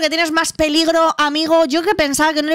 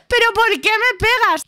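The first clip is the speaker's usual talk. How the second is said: high, whimpering complaint; whiny John; 9 semitones higher than usual. Acted. Beaten John to David, high whimper. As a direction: plead like a hurt kid.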